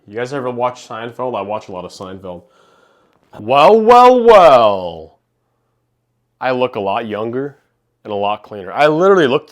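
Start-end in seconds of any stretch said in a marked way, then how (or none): 3.4-5.2 high, loud hammed-up well, well, well; Kramer-style impression. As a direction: play it like a sitcom neighbour bursting in. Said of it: "I look like Kramer".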